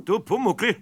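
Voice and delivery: a stern call to catch him out; sharp call. Acted. Eder calls out sternly to the hiding goblin Pumuckl.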